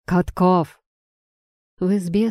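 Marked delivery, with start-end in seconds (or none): 0.0-0.8 loud call across the yard. A narrator's reading. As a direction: call him from outside. Commissar calls sergeant.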